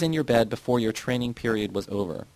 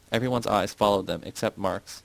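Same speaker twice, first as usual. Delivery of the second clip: usual speaking voice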